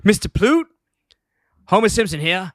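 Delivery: high-pitched, indignant phone complaint; mock-furious customer ringing up to complain; irate Homer. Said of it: "he's irate".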